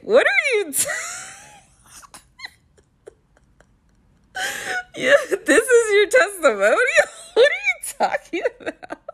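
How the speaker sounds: high, shrieky incredulous questions through laughter; laughing disbelief. Retold or imitated. Viewer talking back to the contestant's testimonial, laughing disbelief. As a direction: retell your outburst, cracking up in disbelief.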